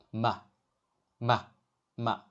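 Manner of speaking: MA short and light, with no held contour; neutral tone. Shown as a demonstration. Teacher demonstrating to learners, MA in a short neutral tone. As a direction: toss MA off quickly and lightly. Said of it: "Say it quickly and softly".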